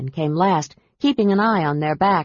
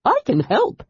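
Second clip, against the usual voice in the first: eager offer; 7 semitones higher than usual. A narrator's reading. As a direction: jump at the chance to help. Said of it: "the Kender offered eagerly".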